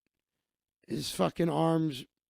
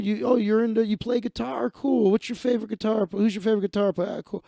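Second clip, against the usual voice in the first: bright, higher chatty host voice, working the room; cheerful high-pitched patter for the group; upbeat magician patter; 6 semitones higher than usual. Retold or imitated. Performing magician greeting the group.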